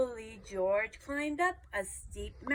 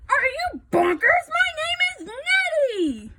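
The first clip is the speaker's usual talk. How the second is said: very high, loud indignant howl; howling monster; 12 semitones higher, 9 dB louder than usual. A narrator's reading. Monster Nettie insulted at being called Yeti, howling. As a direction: howl the protest out. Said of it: "with a horrible howl".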